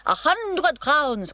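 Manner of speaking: put-on slow, high, loud foreign-accented "A hundred crowns!"; foreign ringmaster accent. A narrator's reading. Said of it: "why is he speaking Arabian".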